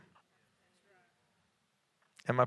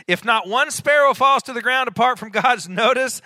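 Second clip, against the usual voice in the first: raised, louder preacher's voice; fervent preaching; 9 semitones higher, 8 dB louder than usual. Real, not acted. Preacher assuring the congregation that God notices, fervent. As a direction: drive it home from the pulpit, high and strong.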